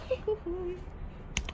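laughing while hurt; wincing laugh; pained laugh. Real, not acted. Laughing through sudden pain.

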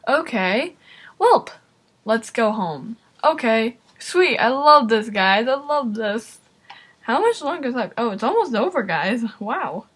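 ordinary talk

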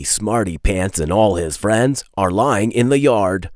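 ordinary delivery

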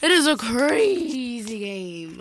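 drawn-out and loud; emphatic slow. Real, not acted. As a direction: lean on crazy, slowly.

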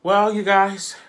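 high, loud sudden call; loud squeal. Real, not acted. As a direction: burst out to the viewers.

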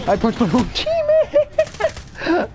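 high, slow, laughing through it. Real, not acted. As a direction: giggle the words out. Laughing at own mistake.

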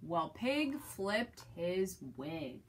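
slow storyteller emphasis; slow dramatic telling. A narrator's reading. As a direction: draw it out slowly for effect. Dramatic read-aloud storytelling beat.

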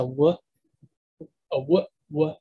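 W as a short, low "wuh"; W said as bare "wuh", not the letter name; W sound. Shown as a demonstration. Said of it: "like with the lips".

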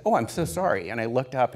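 ordinary delivery

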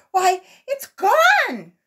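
high, loud and drawn out in shock; alarmed cry. A narrator's reading. Sammy finding the airship gone, shocked cry. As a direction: wail it as if the world just ended. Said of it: "Sammy suddenly cried out".

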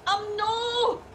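high, indignant refusal; shouting back. Acted. Driver refusing to stop shouting at passenger, indignant. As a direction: throw the refusal straight back at her. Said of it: "Can you please stop shouting at me?".

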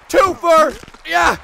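high, loud celebratory shout; excited cheer. Real, not acted. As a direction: shout it as a triumph. Celebrates a double hit.

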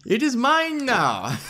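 high, slow mock-villain claim; mock possessive gloating. Jokingly gloats over new character.

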